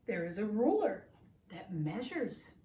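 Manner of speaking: lively, expressive picture-book reading; reading to children. Acted. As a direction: read it like a storybook to little listeners.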